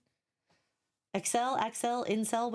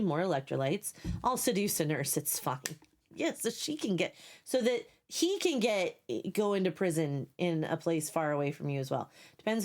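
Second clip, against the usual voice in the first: ordinary delivery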